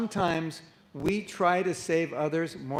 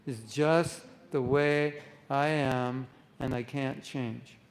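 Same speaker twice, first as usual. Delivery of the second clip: slow, fading, don't-care drawl; lazy resignation; 3 semitones lower, slower than usual. Acted. Teacher voicing lack of self-care, slow resigned shrug. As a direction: sigh it out like nothing can change. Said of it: "Lack of self-care says".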